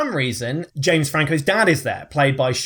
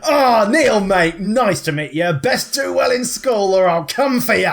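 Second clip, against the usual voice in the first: loud, higher put-on dad voice; tough-customer dad; 6 semitones higher, 4 dB louder than usual. Dad meets his son's friend Neil, loud hammed-up tough guy. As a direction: greet him heartily, then threaten.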